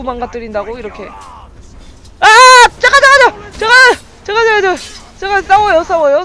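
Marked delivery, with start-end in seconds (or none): 2.8-6.2 high, loud, rapid pleas to wait; alarmed shouting. Real, not acted. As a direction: call out in sudden panic. Caught mid-fight in the game.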